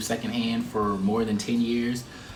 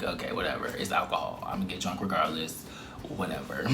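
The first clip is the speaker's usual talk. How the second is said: resigned mutter; 6 semitones lower, 8 dB quieter than usual. Retold or imitated. Resigned inner thought.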